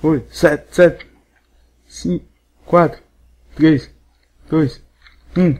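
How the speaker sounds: contando alto e empolgado; contagem animada. Real, not acted. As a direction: conta os segundos finais gritando. Contagem regressiva final. (contagem regressiva empolgada e alta)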